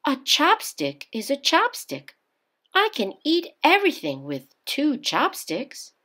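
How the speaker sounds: put-on young Japanese bride, puzzled; mother's voice. A narrator's reading. Mother's voice in story.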